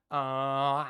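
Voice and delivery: 1 twangy grunt. Shown as a demonstration. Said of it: "practice this".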